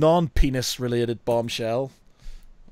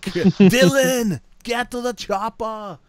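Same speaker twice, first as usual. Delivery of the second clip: higher, drawn-out film quote impression; Arnold Schwarzenegger; 8 semitones higher, 5 dB louder, slower than usual. Host calls out a favourite film quote, Arnie impression. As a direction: play an Austrian action hero. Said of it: "Arnold Schwarzenegger quote".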